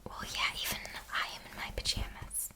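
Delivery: usual speaking voice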